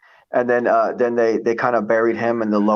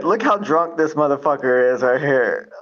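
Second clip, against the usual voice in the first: normal talking voice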